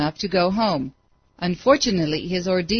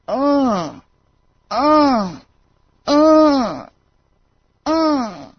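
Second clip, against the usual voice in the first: high, drawn groans swelling louder; eerie groaning; 6 semitones higher, 5 dB louder, much slower than usual. A narrator's reading. Hideous faces groan in the darkness, swelling groans. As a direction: groan long and higher, each falling away.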